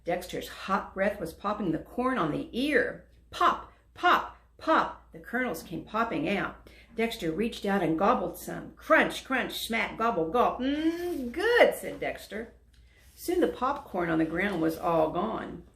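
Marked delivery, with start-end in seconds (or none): 3.3-5.1 popping sounds. A narrator's reading. 10.6-11.7 loud, much higher, savoring; delighted mm. Acted. Dragon tasting popcorn, loud and delighted.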